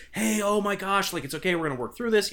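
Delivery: lightly acted worried-parent voice; imitated reassuring parents. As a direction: gush quick reassurance as a mock parent. Voices parents at hospital bedside.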